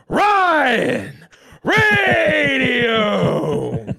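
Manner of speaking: high, drawn-out ring announcer shout; UFC entrance. Shown as a demonstration. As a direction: belt the name out for a fight entrance. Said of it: "a U F C entrance".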